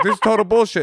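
mock storm-off yell. Kid blowing up at mom before storming off, mock yell. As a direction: act out losing it with your mom. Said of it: "you storm off screaming".

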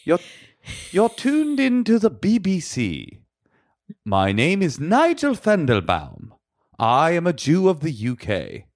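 put-on slow, careful received pronunciation accent; BBC English accent. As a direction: read it like a BBC presenter, RP accent. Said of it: "I'm gonna try to do B B C English".

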